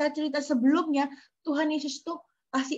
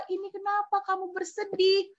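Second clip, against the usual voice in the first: worried friends; 9 semitones higher than usual. Acted. Friends ask the grieving mother.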